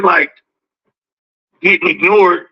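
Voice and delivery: ordinary delivery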